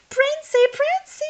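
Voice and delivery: very high, loud squealing call; crying out. A narrator's reading. Witches tug Cinder Hazel from the fireplace, high loud cries. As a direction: shriek for the prince. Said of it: "all the witches cried".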